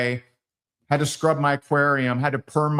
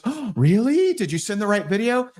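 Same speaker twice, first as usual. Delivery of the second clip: lightly voiced wife; 8 semitones higher than usual. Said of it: "she'll goes".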